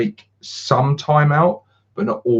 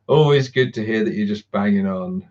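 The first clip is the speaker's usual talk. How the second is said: ordinary delivery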